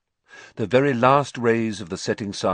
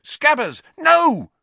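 much higher, loud desperate yell; cry out; 9 dB louder, slower than usual. A narrator's reading. Ron crying out as Scabbers escapes, loud and desperate. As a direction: shout as he slips away.

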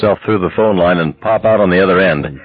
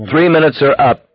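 lightly voiced operator, higher pitch; telephone operator; 7 semitones higher than usual. A narrator's reading. Operator interrupting the couple's call, official and high-pitched. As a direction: cut in as a formal operator.

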